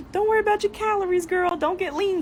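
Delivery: high, fast hype-man voice; mock hype. Hyping up a friend, high fast mock hype.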